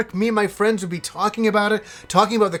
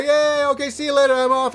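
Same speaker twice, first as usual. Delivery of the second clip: mocking cheer; 8 semitones higher than usual. Mocking Rob's cheerful exit.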